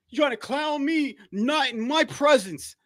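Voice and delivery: high, slow, puffed-up imitation; mocking HOD. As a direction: strut it out like a self-important boss. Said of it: "look how H O D talking".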